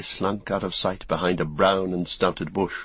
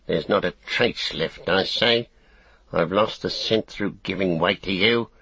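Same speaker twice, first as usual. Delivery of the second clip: small orc tracker. Acted. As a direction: whine it like a sulky tracker orc. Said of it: "it snarled".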